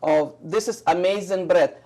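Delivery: ordinary talk, nothing marked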